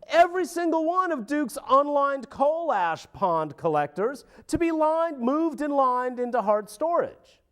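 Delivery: high, amused parody of an official laughing off the demand; mock laughing official. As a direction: chuckle through it as the dismissive official, voiced high.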